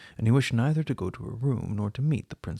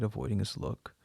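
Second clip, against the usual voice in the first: ordinary delivery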